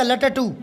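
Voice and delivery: लटटू in three beats, "la-ta-too"; mispronounced लट्टू. Shown as a demonstration. Teacher showing a spelling error, लटटू as "la-ta-too". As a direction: split the double t of लटटू with a vowel. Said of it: "आधा टे नहीं लगाएंगे तो क्या बोला".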